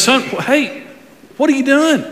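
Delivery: put-on a mother calling after her boy; Mary calling her son. Preacher voicing Mary calling for her missing son.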